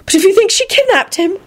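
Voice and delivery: high, bright burst of hope; excited. A narrator's reading. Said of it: "she burst out excitedly".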